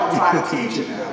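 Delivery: normal talking voice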